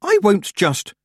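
high, indignant refusal; defiant protest. A narrator's reading. Tiffany refuses to give up the necklace, defiant. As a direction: start to refuse, pitched right up.

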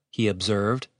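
ordinary delivery, nothing marked

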